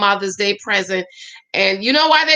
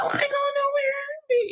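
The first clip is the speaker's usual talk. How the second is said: mock innocent denial; 5 dB quieter than usual. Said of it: "I said".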